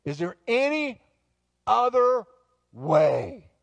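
loud, high, broken into separate words; anguished plea. Acted. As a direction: plead desperately, pausing before the last words. Acting Jesus's anguished prayer.

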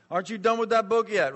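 badly faked Greek accent that comes out Italian. Greek cafe owner teases his neighbour, mock Italian accent. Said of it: "it comes out Italian".

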